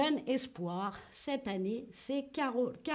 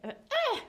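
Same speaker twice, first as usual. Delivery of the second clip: very high tennis-shot cry; tennis grunt; 13 semitones higher than usual. Shown as a demonstration. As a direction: yelp as you swing the racket. Refait le geste avec cri. (cri de frappe très aigu)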